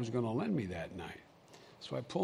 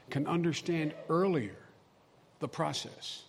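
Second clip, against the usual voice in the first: ordinary talk, nothing marked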